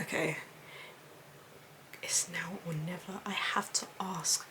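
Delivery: whispered, hushed inner voice; hushed nervous whisper. Retold or imitated. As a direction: whisper it to yourself, scared. Nervous self-talk remembered.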